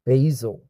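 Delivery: BASIL said "BAY-zul", voiced Z in the middle; Z sound. Shown as a demonstration. Showing it's not S sound, BASIL with a Z. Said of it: "it's a Z sound, not S sound".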